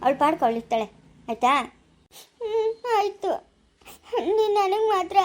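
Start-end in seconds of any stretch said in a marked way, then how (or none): 2.4-5.2 high, crying "ಹ್ಞೂ, ಆಯ್ತು"; sobbing agreement. Acted. Wife yields to husband, high and sobbing. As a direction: give in between sobs.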